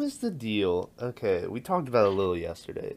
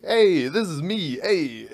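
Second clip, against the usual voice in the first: mock bad actor; 6 semitones higher, 6 dB louder, slower than usual. Imitating actor's bad line.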